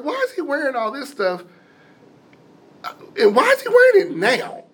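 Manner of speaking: rising panic at the surgical cap; alarmed disbelief. Retold or imitated. Wondering why doctor is dressed up.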